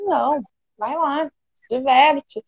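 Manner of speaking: quiet, passive-aggressive permission; grudging, sulky. Shown as a demonstration. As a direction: say yes while clearly meaning no. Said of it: "É totalmente diferente a forma de falar".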